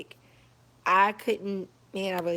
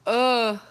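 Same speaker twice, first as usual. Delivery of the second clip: disgusted groan; 4 semitones higher, 7 dB louder than usual. Retold or imitated. Recalling being sick in the car.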